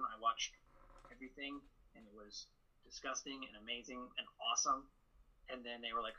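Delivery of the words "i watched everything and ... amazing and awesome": ordinary talk, nothing marked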